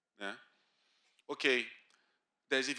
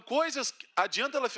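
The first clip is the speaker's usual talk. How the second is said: ordinary delivery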